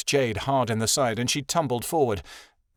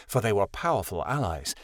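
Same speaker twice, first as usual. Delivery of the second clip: ordinary delivery, nothing marked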